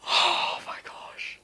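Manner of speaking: slow breathless whisper of awe; awed whisper. Real, not acted. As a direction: barely breathe it, stunned. First sight of Bible.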